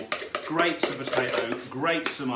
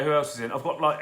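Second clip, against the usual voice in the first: usual speaking voice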